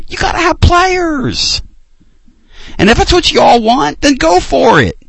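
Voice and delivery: high mocking sneer at fans; sarcastic. Mocking the fans who want the coach, high sarcastic voice.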